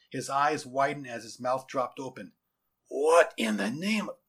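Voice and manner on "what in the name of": slow, loud, rising astonishment; stunned disbelief. Acted. General sighting a giant creature overhead, stunned. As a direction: break off as if dumbfounded.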